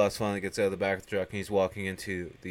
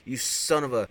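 retold defiant yell, higher and louder; mock angry threat; 7 semitones higher, 4 dB louder than usual. Joe threatening the swamp monster, mock angry. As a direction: act out a gun-toting hero's threat.

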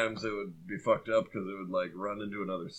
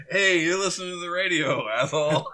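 loud, higher mock DJ call-out; big, raised radio greeting with a laugh at the end; 6 semitones higher, 7 dB louder than usual. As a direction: belt it out like a hammed-up DJ. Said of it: "What did the D J come in and say?".

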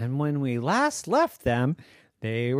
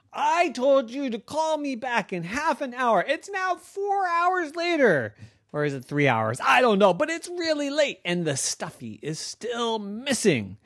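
barking; 9 semitones higher than usual. Acted. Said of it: "barked Alex".

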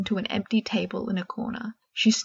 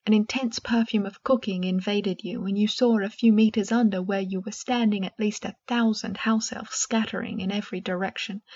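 ordinary delivery

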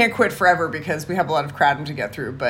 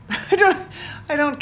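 laughing; 9 semitones higher than usual. Real, not acted.

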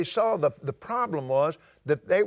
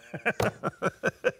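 laugh; 3 semitones higher than usual. Real, not acted. Preacher laughs at his joke.

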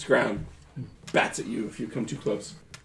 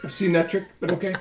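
weasel voice; 6 semitones higher, faster than usual. Acted. GM voices Gerhardt.

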